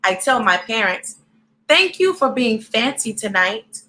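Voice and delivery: lively storybook read-aloud; reading to children. A narrator's reading. Reading a picture book aloud to young viewers, lively.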